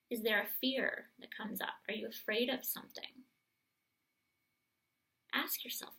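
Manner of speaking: soft, gentle guiding voice; calm guided meditation voice. Acted. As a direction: lead them inward, softly. Guides listeners into their fears.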